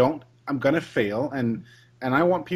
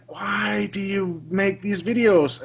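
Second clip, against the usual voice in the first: slow, stretched, higher-pitched plea; exasperated; 6 semitones higher, slower than usual. Retold or imitated. Reviewer to trick-shot video makers, exasperated. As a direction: drag it out in baffled despair. Retold reaction to partnership applications.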